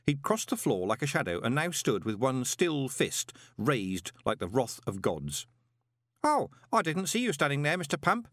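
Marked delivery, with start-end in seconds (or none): 6.2-8.3 high, chirpy innocent greeting; cheerful boy. A narrator's reading. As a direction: chirp it like an oblivious lad. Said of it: "said Stanley cheerfully".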